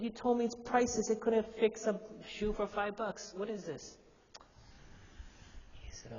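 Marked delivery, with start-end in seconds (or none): 0.0-4.0 higher, louder protest, feeling cheated; a kid who feels lied to; indignant complaint. Retold or imitated.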